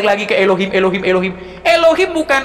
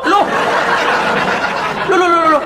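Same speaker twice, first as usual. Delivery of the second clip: laughing disbelief; 6 semitones higher than usual. Real, not acted. Mocking disbelief in debate.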